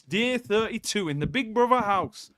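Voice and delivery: a hint of the Geordie Big Brother host; Geordie Big Brother narrator. Fan jokingly announcing the house day as a Geordie host. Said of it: "my Geordie accent of the guy who presents, uh, Big Brother".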